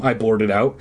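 ordinary delivery

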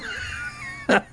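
shrill high cackle. Acted. Laugh after the story's punchline.

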